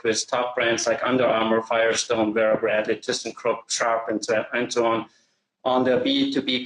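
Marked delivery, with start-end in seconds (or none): none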